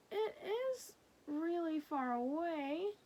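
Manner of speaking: drawn-out protest; insisting child. Acted. Lulu insists slowly.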